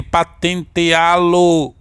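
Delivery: "patenteá-lo" slowly split into syllables, final LO held. Shown as a demonstration. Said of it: "você faz a separação silábica". ("patenteá-lo" devagar, sílaba por sílaba)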